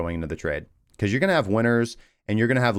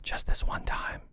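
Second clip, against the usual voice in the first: hushed tempting inner voice; whispering; 8 dB quieter than usual. Retold or imitated. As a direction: whisper it like a devil on your shoulder.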